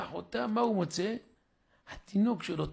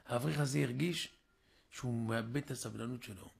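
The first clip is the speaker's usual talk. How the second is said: normal talking voice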